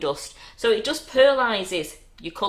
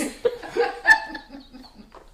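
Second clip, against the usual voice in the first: high breathy villain giggle; evil chuckle. Acted. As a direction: wheeze out a wicked laugh. Continuing evil cackle.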